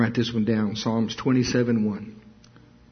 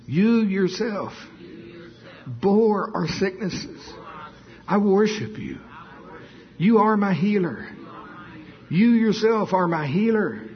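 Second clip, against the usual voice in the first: fervent worship; 6 semitones higher than usual. Acted. Preacher leading the church in high fervent worship prayer. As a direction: lift it up in praise. Leads worship at rising pitch.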